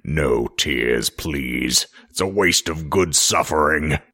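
low, slow menacing villain voice; Pinhead voice. Retold or imitated. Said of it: "He would say this phrase".

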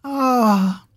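high pained screech; defeated screech. Acted. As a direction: shriek as she collapses. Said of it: "The defeated screech pierced".

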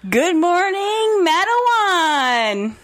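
drawn-out, high radio DJ shout; Robin Williams impression. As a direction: belt it like a morning DJ. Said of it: "Is that a Robin Williams Good Morning Vietnam reference?".

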